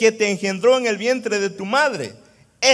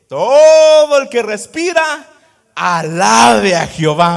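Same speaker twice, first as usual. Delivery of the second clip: preaching shout; 3 semitones higher, 14 dB louder, slower than usual. Acted. Preacher calls the congregation to praise, shouting slowly. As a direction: cry it out to the whole church, slowly. Preaching a psalm at full force.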